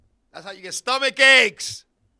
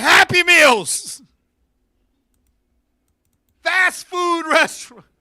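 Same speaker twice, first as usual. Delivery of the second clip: comic shout; 6 semitones higher, 9 dB louder, slower than usual. Real, not acted. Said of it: "comic shout".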